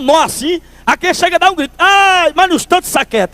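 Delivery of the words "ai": pinched yelp. Retold or imitated. Pinched child cries out.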